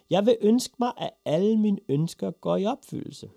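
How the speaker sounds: put-on high, loud boy's voice; excited boy repeating his big wish; proud proclamation. A narrator's reading.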